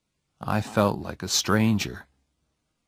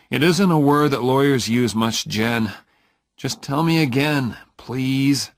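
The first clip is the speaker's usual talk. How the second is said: louder, higher, insistent; irritated plea; 5 semitones higher, 5 dB louder than usual. A narrator's reading. Oliver nags Jenny to repeat it, louder. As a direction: push her to tell it again.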